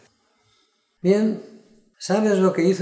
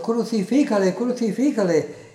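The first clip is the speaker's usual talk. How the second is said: higher-pitched repeated crowd cry; chant-like demand from the mob, read with raised voice; crowd shouting; 7 semitones higher than usual. A narrator's reading. Crowd refusing Pilato's offer, narrated crowd shout. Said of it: "a dar voces diciendo".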